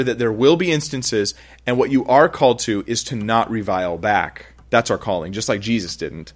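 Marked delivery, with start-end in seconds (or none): none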